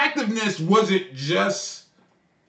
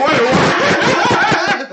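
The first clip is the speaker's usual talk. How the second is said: high, loud startled laugh-yelp; laughing mock shock; 8 semitones higher, 6 dB louder than usual. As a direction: act floored by the wife's advice. Shocked at wife's suggestion.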